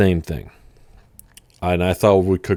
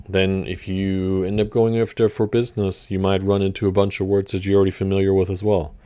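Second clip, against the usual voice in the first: normal talking voice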